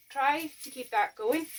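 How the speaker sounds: ordinary talk, nothing marked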